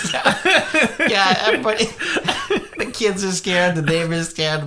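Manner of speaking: laughing through the words, high and giddy; cracking up while telling it. Real, not acted. Laughing at his own story.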